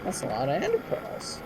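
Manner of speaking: put-on animated reading to children; lively fairy tale voice; storybook narration. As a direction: tell it like a bedtime tale. Tells Chicken Little story.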